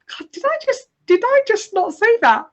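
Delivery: acted-out exasperated thought, pitched very high; frustrated disbelief. Woman ignored in a meeting, thinking to herself, frustrated. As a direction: squeak it in mock bewildered frustration. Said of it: "so frustrating 'cause you're sat there thinking".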